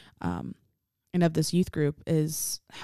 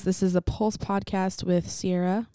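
ordinary talk, nothing marked

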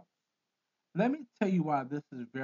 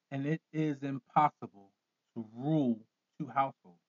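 usual speaking voice

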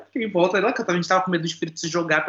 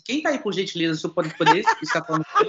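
meek, over-courteous request in an ordinary pitch; exaggerated politeness. Man placating a spirit he offended, mock-polite. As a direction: backpedal with comically formal manners. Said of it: "fui grosseiro, fui, fui mal educado".

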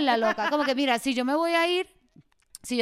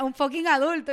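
normal talking voice

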